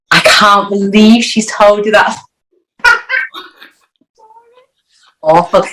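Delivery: ordinary talk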